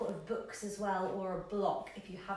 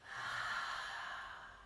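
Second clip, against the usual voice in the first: long sighing breath out; demonstrated exhale; 7 semitones lower, 5 dB quieter than usual. Shown as a demonstration. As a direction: let a long breath go through the mouth. Demonstrates breathing out through mouth.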